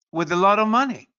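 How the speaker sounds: normal talking voice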